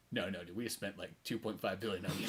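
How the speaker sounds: very low, soft deadpan; mock calm refusal. Retelling the company refusing to let him die, low soft deadpan. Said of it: "everybody's kinda like".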